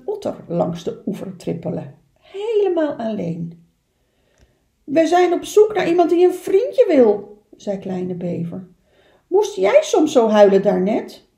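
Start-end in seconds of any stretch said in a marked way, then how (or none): 4.8-7.4 high, loud small animal; little beaver voice. Acted. Little beaver asking a lone duck, high loud voice. 9.3-11.3 loud, earnest question; little beaver voice. Acted. Little beaver asking the otter who cried, loud questioning voice.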